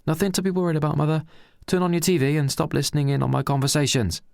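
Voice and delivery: impatient son. Acted. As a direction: shut her down loudly. Son dismisses nosy mother.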